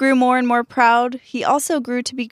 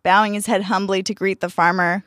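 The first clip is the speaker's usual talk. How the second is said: usual speaking voice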